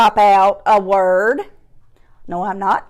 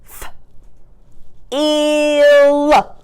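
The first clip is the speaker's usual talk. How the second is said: "F-, ill" split, vowel held long and higher; chopped-out word; 4 semitones higher than usual. Shown as a demonstration. Teacher sounding out a word for pupils, stretched apart. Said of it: "chop out this word".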